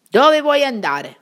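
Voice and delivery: armed sentry barking at the shepherd; gruff sentry challenge. A narrator's reading. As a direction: stop the stranger at the gate.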